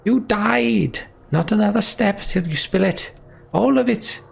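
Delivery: high-pitched, demanding shout. A narrator's reading. Ollie demanding the truth from Juno, shouting. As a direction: shout it high, forcing an answer out of her. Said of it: "shouts Ollie".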